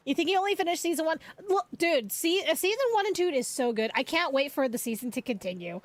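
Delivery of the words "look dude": ordinary delivery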